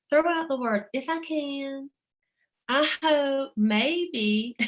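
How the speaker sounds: a playful take on the founder's voice; imitating Mary Kay. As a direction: mimic her drawn-out dismissal of weak words. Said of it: "and she would go".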